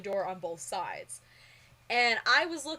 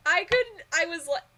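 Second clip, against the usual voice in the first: flustered excitement; 8 semitones higher, 9 dB louder than usual. Retold or imitated. Flustered after seeing producer.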